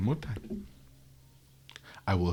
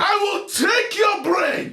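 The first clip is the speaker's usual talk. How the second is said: high shrieking threat; furious shout; 6 dB louder, slower than usual. Acted. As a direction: scream it, slow and wild.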